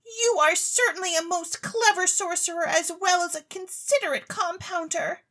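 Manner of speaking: put-on high, eager young lady pleading; a rich, silly girl begging to go on stage; Claribel's voice. Claribel begs the chemist for stage talent, high and eager.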